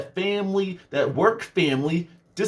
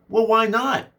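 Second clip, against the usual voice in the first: mock boss; 4 semitones higher than usual.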